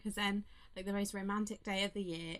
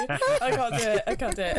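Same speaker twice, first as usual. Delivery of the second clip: loud, laughing through words; giving up, still laughing loud; 3 semitones higher, 9 dB louder than usual. Real, not acted. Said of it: "I'm just reading it out normally".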